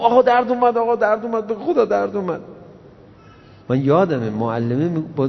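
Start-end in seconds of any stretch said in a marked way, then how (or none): 0.0-2.4 whiny pupil. Retold or imitated. Punished pupil complains to teacher.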